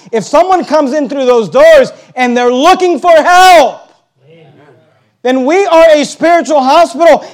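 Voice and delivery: forceful, high-pitched pulpit delivery; impassioned preaching. Real, not acted. Preacher promising help to anyone who seeks it, impassioned.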